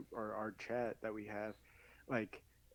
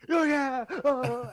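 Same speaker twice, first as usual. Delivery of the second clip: excited yell; 13 semitones higher, 10 dB louder, slower than usual. Retold or imitated. Fighter eagerly accepting a call-out.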